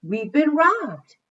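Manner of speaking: shocked clerk yelling; panicked cry. A narrator's reading. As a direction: cry it out in alarm. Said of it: "screamed a clerk".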